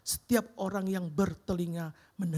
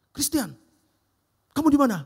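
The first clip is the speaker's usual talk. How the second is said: boss calling; 5 semitones higher, 10 dB louder than usual. Retold or imitated.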